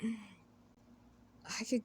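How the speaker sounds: slow, sighing tenderness. Real, not acted. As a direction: breathe it out, lovestruck. Sigh of deep affection.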